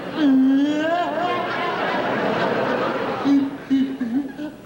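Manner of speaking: laughing till it nearly turns to tears; helpless teary cackle; crying laughter. Acted. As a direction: lose it in wheezy laughter. Laughing at Bailey's face.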